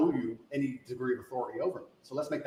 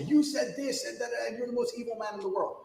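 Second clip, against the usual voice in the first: mock accusation in a raised, high-pitched voice; mocking accuser; 8 semitones higher than usual. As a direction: mimic the interviewer accusing you, pitched well up. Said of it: "and then come along and say".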